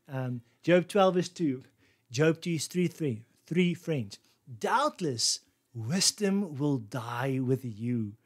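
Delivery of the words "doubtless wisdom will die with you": very slow, dry mock praise; sarcastic. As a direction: lay on the irony heavily. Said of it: "That's so funny".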